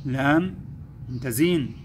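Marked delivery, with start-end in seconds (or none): none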